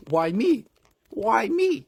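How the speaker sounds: high, loud pleading cry; whiny plea. Acted. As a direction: wail it like a beaten victim. Chris beaten, pleading.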